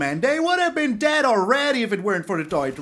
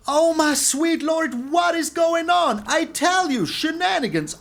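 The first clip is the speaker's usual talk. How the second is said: exasperated exclaim; 7 semitones higher than usual. Real, not acted. Streamer reacting to opponent's card with exasperated cry. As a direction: throw up your hands at the board.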